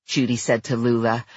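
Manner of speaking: usual speaking voice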